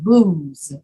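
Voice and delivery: booze drawn out with a buzzing Z at the end; voiced Z. Shown as a demonstration. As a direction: keep the voice on through the Z of booze. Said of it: "use your voice to say the Z".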